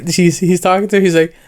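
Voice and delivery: normal talking voice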